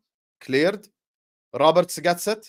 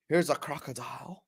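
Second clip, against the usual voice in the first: put-on failed Australian accent; 7 dB quieter than usual. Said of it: "I cannot do an Australian accent".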